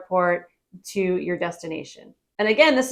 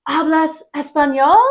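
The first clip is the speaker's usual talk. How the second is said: lightly voiced driver; 6 semitones higher, 5 dB louder than usual. Excited driver asks you.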